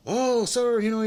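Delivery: high, loud imitation of a panicked worker on the phone; mock worker on the phone. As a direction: chirp it up high like he's rushing. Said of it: "you can hear this very fast talking".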